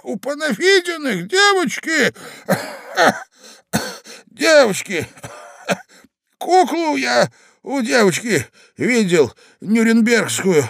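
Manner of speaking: put-on high, slow old man rambling about a doll; doting old man. A narrator's reading. Old husband babbles to his wife about a doll.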